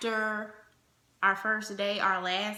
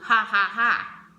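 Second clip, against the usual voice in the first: mock laugh; 6 dB louder, slower than usual. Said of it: "he was just like".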